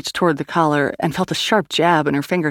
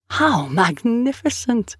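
slow, higher-pitched wonder; marveling; 7 semitones higher, slower than usual. A narrator's reading. Swede relishing Grace's offer of her life, marveling. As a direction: savour it like a delighted villain. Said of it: "the Swede marveled".

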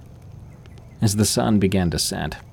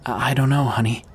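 shakily; 4 semitones higher, faster than usual. A narrator's reading. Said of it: "Joyce replied shakily".

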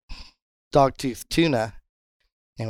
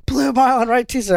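high, loud call-out; yelling; 12 semitones higher, 6 dB louder than usual. Retold or imitated. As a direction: holler an urgent sighting to the crew. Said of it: "I'm, like, yelling".

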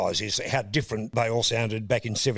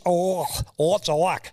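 commentator impression; 5 semitones higher, 4 dB louder, slower than usual. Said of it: "he had this".

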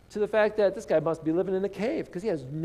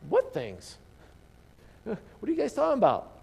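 playing dumb; 5 semitones higher than usual. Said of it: "Jesus says".

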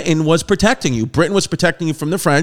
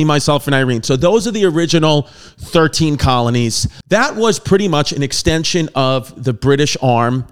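normal talking voice

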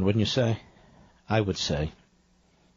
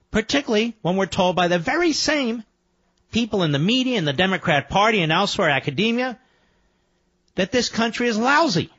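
indignant; 8 semitones higher than usual. Real, not acted. Host rants about claims country is lousy.

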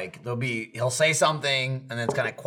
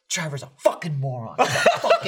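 mimed mouthing. Retold or imitated. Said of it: "he starts mouthing".

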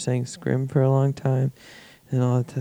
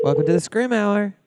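higher, announcer-style welcome; show host intro; 7 semitones higher than usual. Acted.

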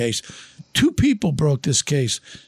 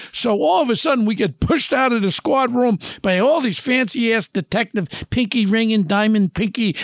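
ordinary talk, nothing marked

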